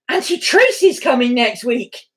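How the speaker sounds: high, thrilled announcement to a dog; excited pet talk. Real, not acted. Owner telling her dog that Auntie Tracy's coming, excited pet talk. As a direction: build the excitement, pitch climbing.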